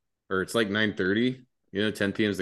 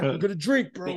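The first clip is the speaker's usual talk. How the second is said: much higher excited chant; sing-song drunken cheer; drunk hype; 11 semitones higher than usual. Retold or imitated. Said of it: "And I'm like".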